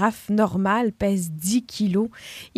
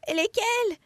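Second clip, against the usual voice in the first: high, quavering, scared; trembling with fear; 13 semitones higher than usual. Acted. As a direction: quaver with terror. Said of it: "un trémolo de terreur dans la voix".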